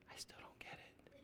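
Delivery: barely audible whispered complaint; whispered confusion; defeated child's whisper. Acted. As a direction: whisper it like a lost, discouraged kid. Voices children who don't understand.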